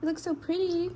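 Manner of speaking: cooing. Real, not acted. Talking to the dog.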